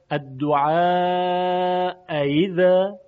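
slow, long الدعاء gliding into ایذا; الدعاء ایذا with the second hamza between hamza and ya; tashil, softened hamza. Shown as a demonstration. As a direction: stretch الدعاء, then ease into ایذا without a glottal break. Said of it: "با صدایی بین همزه و یا".

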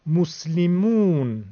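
مسلمون very slow, 'mus-li-moon' with held 'oo'; short 'mu', then stretch 'moo' in مسلمون; long vav madd. Shown as a demonstration. Teacher contrasting damma and vav, drawn-out مسلمون. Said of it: "میم بعدی در همین کلمه واو مدی".

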